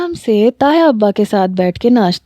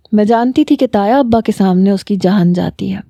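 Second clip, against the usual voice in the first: usual speaking voice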